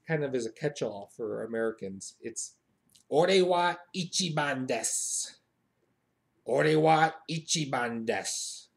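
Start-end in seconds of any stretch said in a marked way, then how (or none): 3.1-8.7 slow, drawn-out put-on swagger; emphatic, self-important Japanese catchphrase; boastful declaration. Shown as a demonstration. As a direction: proclaim it like a champion, stretching the ending.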